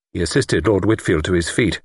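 usual speaking voice

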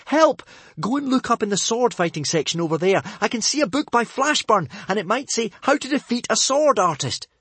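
high, loud frightened plea; panicked shout. Acted. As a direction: cry out for help mid-fight. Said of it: "shouted Hiccup shakily".

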